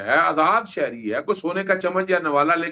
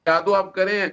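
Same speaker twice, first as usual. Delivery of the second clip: usual speaking voice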